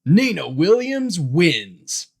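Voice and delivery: loud, drawn-out victory announcement; slow, high, swooping winner call; video game announcer yell. A narrator's reading. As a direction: boom it out like a fighting game announcer. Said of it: "The PlayStation yelled out".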